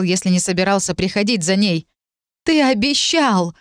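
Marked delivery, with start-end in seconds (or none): 2.4-3.5 high, slow anguished cry. Acted. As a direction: call out into the dark, hurt. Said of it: "выкрикнула она во тьму".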